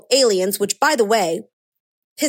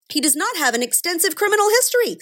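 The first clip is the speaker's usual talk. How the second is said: higher-pitched mock insistence; sarcastic emphasis; 7 semitones higher than usual. Commentator relaying the police chief's claim, sarcastic. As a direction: relay it as an absurd excuse.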